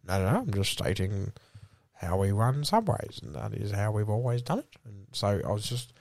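low, measured company spiel; corporate spokesperson. Acted. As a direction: recite the official policy.

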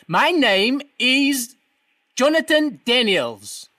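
slow, drawn-out, over-articulated; speaking slowly to hard of hearing. Acted. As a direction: spell it out slowly for a deaf man. Caller told to speak slowly.

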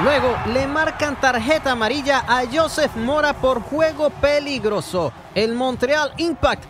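ordinary talk

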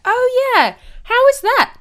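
excited. Real, not acted. Excited question about family visit.

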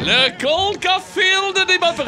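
high, slow, loud boast; announcer shout. Acted. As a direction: proclaim it like a champion. Boasting a nickname for himself.

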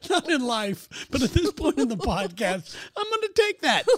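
talking through a high, loud laugh; real laughter breaking through the line. Real, not acted. Laughing banter with co-host.

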